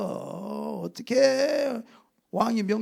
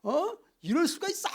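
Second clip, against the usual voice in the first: retold royal fury; 6 semitones higher than usual. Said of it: "막 고함을 막 질러대는 거예요".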